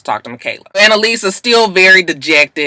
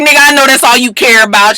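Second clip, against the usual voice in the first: louder and higher snap at him; loud retort; 7 semitones higher, 6 dB louder than usual. Retold or imitated. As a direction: bark it back at him, fed up. Bonnie snapping at Frank.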